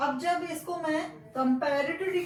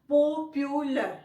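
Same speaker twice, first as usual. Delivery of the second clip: "Popular" slowly, in three separated parts; split into syllables; 3 dB louder, much slower than usual. Shown as a demonstration. Teacher showing pronunciation in three parts, "popular" syllable by syllable. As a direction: sound out "popular" one syllable at a time. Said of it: "तीन parts करके उच्चारण कर".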